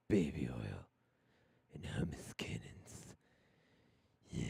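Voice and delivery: low, slow, hushed tease; teasing whisper. Pretend. Promising a viewer they're covered, teasing low whisper. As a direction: drop into a playful, sultry-joke whisper.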